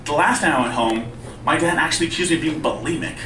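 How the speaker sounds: ordinary talk, nothing marked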